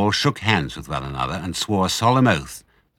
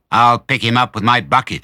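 loud, forceful farmer's call; shouted boast; 3 semitones higher, 6 dB louder than usual. A narrator's reading. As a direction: shout a threat over the engine. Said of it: "shouted Bunce".

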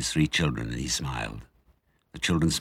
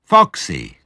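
glad cry; 8 dB louder than usual. Acted. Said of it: "cried Badger".

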